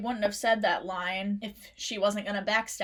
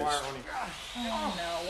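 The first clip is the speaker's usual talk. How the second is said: normal talking voice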